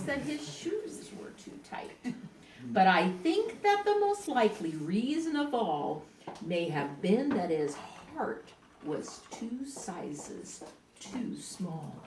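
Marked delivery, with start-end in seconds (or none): 0.0-1.9 whispered aside, breathy and hushed. A narrator's reading. Narrator whispering the joke to the listeners. 6.4-11.9 hushed, low narrator voice. A narrator's reading. Narrator softening the sad truth, low and hushed.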